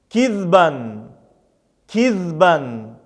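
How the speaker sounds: measured, drawn-out word with a falling end; Arabic reading drill. Shown as a demonstration. Teacher models tanween examples for learners, careful drawn-out recitation. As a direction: recite each word singly, stretching the long vowels.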